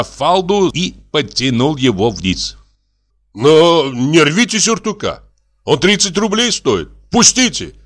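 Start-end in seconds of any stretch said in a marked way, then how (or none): 3.3-7.7 high, rising plea to let go; agitated protest. Acted. Doctor pulled by the coat, agitated protest. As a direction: protest higher and higher.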